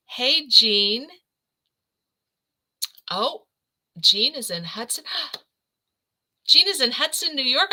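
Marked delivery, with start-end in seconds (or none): 5.7-7.7 gasp, then higher and louder with delight; thrilled by the news, real delight; excited gasp. Real, not acted. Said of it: "I'm so excited".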